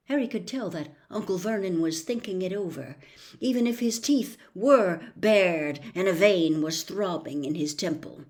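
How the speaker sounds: usual speaking voice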